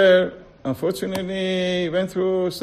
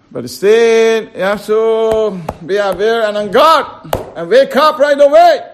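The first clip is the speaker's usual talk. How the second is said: louder and higher, pressing hard; preacher building to a loud appeal; rising exhortation; 4 semitones higher, 7 dB louder than usual. Real, not acted. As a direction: urge them to wake up now. Urging congregation to wake up.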